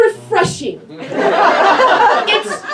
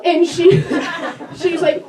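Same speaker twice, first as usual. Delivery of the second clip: ordinary talk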